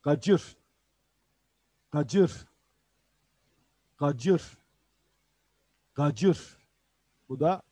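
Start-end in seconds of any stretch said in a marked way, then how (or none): 0.0-6.6 Gacır said with a rising tune; Urdu pronunciation. Shown as a demonstration. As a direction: model Gacır for students to echo. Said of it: "Havuç var". (Gacır kelimesini yükselen tonla örnekle)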